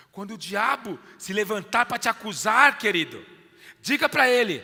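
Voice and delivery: loud rising sermon push; preaching at full force. Acted. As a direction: preach it out to the crowd. Preacher exhorting congregation. (pregação em voz alta)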